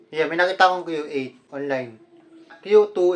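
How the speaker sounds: ordinary delivery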